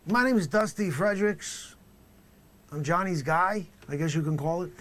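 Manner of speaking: comic dealer introducing himself; loud character voice. Acted. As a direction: brag it like a proud dealer.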